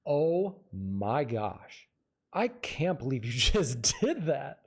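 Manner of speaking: very slow, separated 'Oh... my gosh'; different third take. Shown as a demonstration. Acting coach demonstrating take three, slow and deliberate disbelief. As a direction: spell out the disbelief slowly, then hit DID. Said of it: "Take three".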